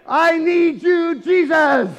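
full, high, drawn-out shout. Acted. Believer calling on Jesus, full desperate shout. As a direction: cry out slowly at full voice. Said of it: "shout all the louder".

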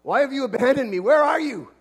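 loud, high, pleading cry to God; angry prayer. Acted. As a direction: hurl the question upward like an abandoned child. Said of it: "we even cry that same cry".

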